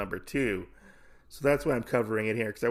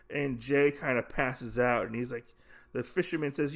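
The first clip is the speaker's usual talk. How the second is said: usual speaking voice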